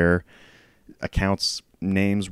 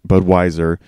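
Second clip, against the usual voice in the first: ordinary talk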